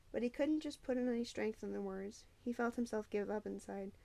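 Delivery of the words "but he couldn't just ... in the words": plain reading voice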